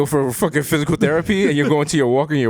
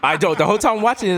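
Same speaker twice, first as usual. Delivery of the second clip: ordinary talk, nothing marked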